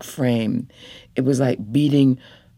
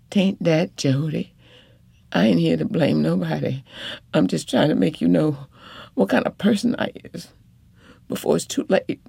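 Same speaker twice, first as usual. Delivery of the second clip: higher, fast, pressing woman's voice; urgent wife; 5 semitones higher, faster than usual. Acted. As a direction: hurry to say it before it's too late.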